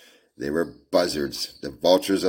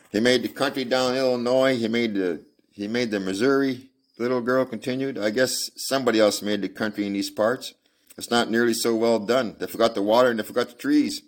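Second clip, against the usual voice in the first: put-on higher childlike voice, chattering on; little girl voice; 7 semitones higher, 4 dB louder than usual. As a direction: play the girl musing about the land.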